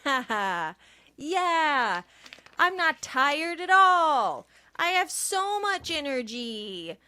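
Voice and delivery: forced high excited voice; fake hype. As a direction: pump yourself up, hammed. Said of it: "Sound like a witch".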